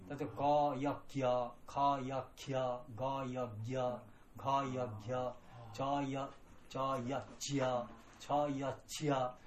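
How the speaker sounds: teacher drilling ガーヤキヤ and チャーヤチヤ sounds; syllable drill. Shown as a demonstration. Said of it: "これ練習いっぱい".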